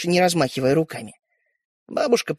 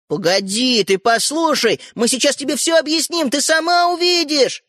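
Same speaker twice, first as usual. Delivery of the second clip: shouting; 7 semitones higher, 3 dB louder than usual. A narrator's reading. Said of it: "закричали братья".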